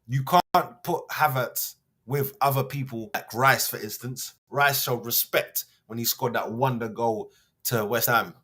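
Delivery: ordinary delivery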